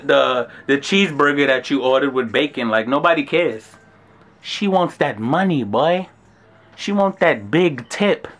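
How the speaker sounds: ordinary delivery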